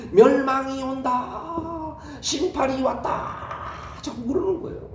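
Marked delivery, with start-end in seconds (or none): 0.1-3.5 slow proclamation. Acted. Enoch proclaiming doom daily.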